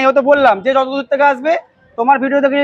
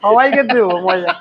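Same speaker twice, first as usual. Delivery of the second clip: laughing; 3 semitones lower than usual. Real, not acted. Dealer jokes about giving fun.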